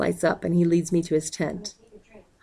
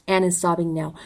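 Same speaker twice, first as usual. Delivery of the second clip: ordinary delivery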